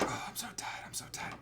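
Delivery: soft, whispered weariness; acted, hushed exhaustion; mock exhausted whisper. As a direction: whisper it like a worn-out medic. Retold exhausted medic.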